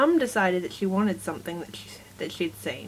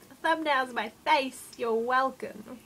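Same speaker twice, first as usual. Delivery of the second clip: higher, cocky "you're welcome" drawn out slowly; jokey smug; 4 semitones higher than usual. Retold or imitated. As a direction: play it like a proud showman. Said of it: "it's like a jokey mood".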